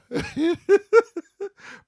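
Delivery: loud high cackle; high laugh. Real, not acted. Guest bursts into high laughter at the host. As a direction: crack up out loud. Cracking up.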